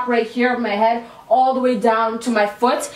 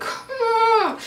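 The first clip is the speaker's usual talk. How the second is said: high, strangled whimper held back; a tiny high whine fighting tears; 11 semitones higher than usual. Retold or imitated. About to cry in paralysis.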